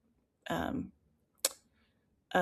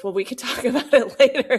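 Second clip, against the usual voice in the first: a real laugh breaking into the therapist's words, higher and louder; laughing quote; 6 semitones higher, 7 dB louder, faster than usual. Real, not acted. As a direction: laugh through the retold offer.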